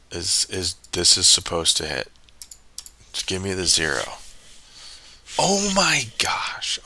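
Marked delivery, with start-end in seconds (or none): 5.4-6.8 high, slow breathy oh my gosh; exasperated gasp. Real, not acted. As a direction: gasp it out in dismay. Reacts to loss.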